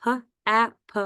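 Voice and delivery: "h, a, p" as separate speech sounds; letter sounds. Shown as a demonstration. Teacher sounding out the first syllable, letter sounds. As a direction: sound out h, a, p one by one. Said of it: "So our first syllable".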